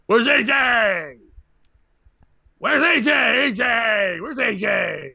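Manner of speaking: put-on drunk yelling. Drunk guy heckling the comic, demanding loudly. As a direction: keep hollering the question like a drunk.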